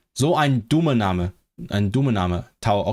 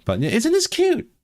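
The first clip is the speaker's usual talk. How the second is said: very high-pitched gushing over something cute; cooing delight. Real, not acted. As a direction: coo it, voice leaping up high.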